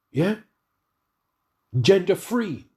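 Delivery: ordinary talk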